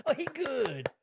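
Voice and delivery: lightly voiced impression of an amused old man; Coming to America guy impression. Comedian imitates a movie character, lightly voiced. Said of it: "remember the guy in Coming to America".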